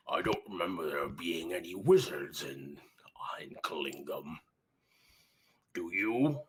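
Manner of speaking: low, suspicious whispered threat; menacing orc whisper. Acted. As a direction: lean in and whisper the accusation like a wary warlord. Orc leader questions the wizard.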